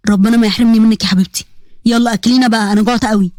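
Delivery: low voice, gently pleading. Acted. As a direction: ask a favour like a loving uncle.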